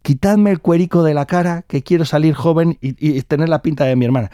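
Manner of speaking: high, loud insistence; desperate demand. Acted. Old sister orders the young men to skin her, urgent insistence.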